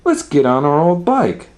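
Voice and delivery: high, loud cheerful exclaim; Mama Bear delighted. A narrator's reading. Mama Bear agreeing to a bike ride, high and cheerful. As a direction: cheer the idea. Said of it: "said Mama Bear".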